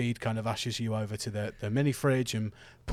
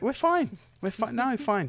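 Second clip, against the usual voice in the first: defensive; 10 semitones higher than usual. Acted. Caught lying after scuffle.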